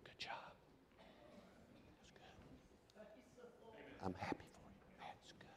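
soft breathy whisper of praise; God whispering. Pretend. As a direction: lean in and whisper it like God in your ear. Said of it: "He's gonna whisper in your ear".

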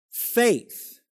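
"faith" lifted high and held; stress on faith. Shown as a demonstration. Preacher showing Galatians' emphasis, faith stressed high. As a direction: land hard on "faith". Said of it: "faith is the emphasis there".

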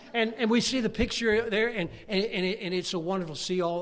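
loud, high, quick telling; excited storytelling. Acted. Preacher telling the church about the family photo frame, excited and loud. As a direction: rush through it with delight.

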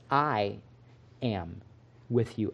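"I am with you" said very soft and slow, each word spaced; slow, hushed emphasis. Shown as a demonstration. Preacher lingering on Jesus' promise, hushed and slow. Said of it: "Notice that Jesus says".

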